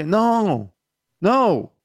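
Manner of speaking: mock scolding. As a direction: put your foot down playfully. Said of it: "And I'm like".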